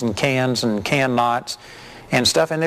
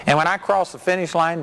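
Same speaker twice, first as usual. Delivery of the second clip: ordinary talk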